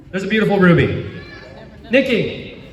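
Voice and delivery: ordinary talk, nothing marked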